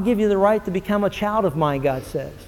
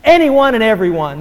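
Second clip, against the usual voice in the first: raised, ringing pulpit delivery; full-force preaching; 5 semitones higher, 5 dB louder than usual. Real, not acted. Preacher proclaiming salvation to the congregation, full force. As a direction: drive it home to the congregation.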